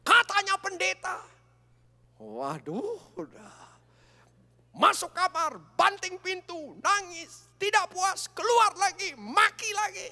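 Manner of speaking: high, loud rapid list of her rage; excited loud retelling. Real, not acted. Recounting wife's rampage at full force.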